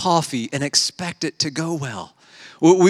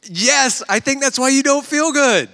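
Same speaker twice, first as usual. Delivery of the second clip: exasperated disbelief; 10 semitones higher than usual. Retold or imitated. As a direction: pitch jumps high in mock disbelief. Exasperated at obvious diet cause.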